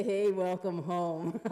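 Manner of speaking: loud, low, slow put-on voice; mosquito character. Mosquitoes greeting the girl in story.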